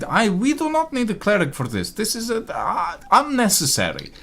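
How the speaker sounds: the nervous noble trying to stop the cleric; nervous objection. Acted. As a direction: brush it off too quickly.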